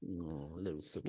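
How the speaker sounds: low, very soft cooing; tender pet talk. Real, not acted. Podcaster fussing over the little sookie cat, soft and tender. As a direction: fuss softly over a pet, voice dropped.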